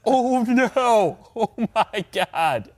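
high, drawn-out cry of dismay; hammed-up high-pitched dread; mock horror. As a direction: act out horrified teammates, slowing down with a laugh. Retold teammates' horror at the prank.

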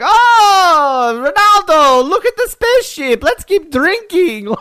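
very high, merry party voice; carefree partygoers cheering, slowing at the end; mock partying Brazilians. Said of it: "party folk".

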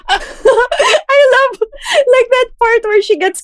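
high laughing squeal, almost crying. Real, not acted. Squealing laugh about the scene.

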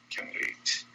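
a soft unvoiced repeat of can't wait; whispered echo. Real, not acted. Guest whispers her excitement again to herself. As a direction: breathe it out to yourself. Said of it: "I can't wait".

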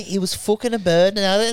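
put-on English lad's accent; English pub bloke. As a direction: blurt it out in a broad English accent. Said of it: "some English guys sitting around going".

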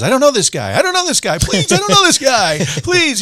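mock panic, denying a friend to save himself; terrified denial. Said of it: "He's terrified".

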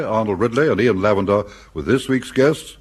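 ordinary talk, nothing marked

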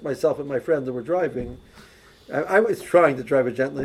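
ordinary talk

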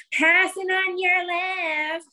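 high, slow, sing-song call; passing cyclist calling out. Retold or imitated. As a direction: stretch it out like an eager kid. Daughter calling while passing.

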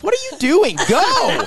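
high laughing urging; impatient yell. Retold or imitated. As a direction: hurry the slow young driver. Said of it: "I'm like".

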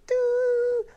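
high piping doo like a pitch pipe; pitch pipe imitation. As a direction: mimic the pipe's tone. Rabbi blowing pitch pipe.

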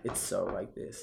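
slow lazy creaky drawl; imitating a lazy fry voice; vocal fry. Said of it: "You got a lot of that vocal fry going on".

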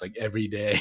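ordinary delivery

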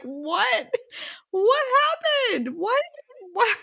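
rising confused cries; high bursts of disbelief; shocked. Retold or imitated. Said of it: "we're all like".

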